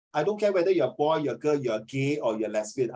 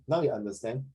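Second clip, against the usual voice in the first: normal talking voice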